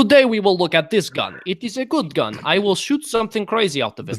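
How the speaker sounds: hammed-up Russian gun-reviewer voice; fake Russian accent. Streamer imitating a gun YouTuber in a fake Russian accent. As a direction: do a mock Russian accent, punching each phrase. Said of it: "like, a fake Russian accent".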